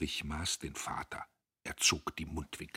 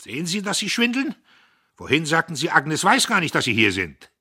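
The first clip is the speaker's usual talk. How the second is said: much higher and louder sneer; mocking; 11 semitones higher, 13 dB louder than usual. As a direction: triumph over a caught liar. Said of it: "lachte höhnisch auf". (Diederich verhöhnt den Vater: laut und höhnisch)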